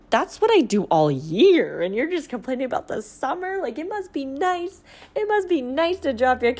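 incredulous, pitched high and sarcastic; indignant retort. Retold or imitated. Stay-at-home mom scoffing at summer complaints, pitched high. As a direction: scoff it, hurt and disbelieving. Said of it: "And I'd be like".

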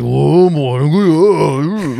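hammed-up drunk neighbour calling good morning; tipsy old men's greeting. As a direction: stretch the greeting out like a boozy old man. Drunk old men greeting at 8am.

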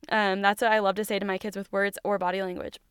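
ordinary talk, nothing marked

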